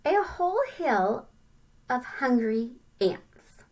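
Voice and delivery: normal talking voice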